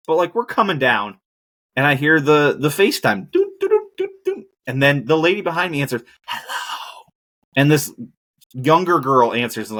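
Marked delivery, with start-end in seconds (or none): 3.3-4.5 very high beeping doot sounds; imitated ringtone. 6.3-7.0 whispered hello. Retold or imitated. Lady answering quietly on plane.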